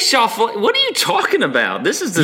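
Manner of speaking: half-laughing protest at a co-host's jab; incredulous protest. Real, not acted. Protesting the teasing.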